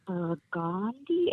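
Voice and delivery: "Burgundy" said slowly as bur-GAHN-dee, rising; hesitant mispronunciation. Retold or imitated. People timidly trying her name, "Burgundy" mispronounced as bur-GAHN-dee. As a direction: stretch "Burgundy" into a questioning bur-GAAHN-dee. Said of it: "they're scared to mispronounce my name".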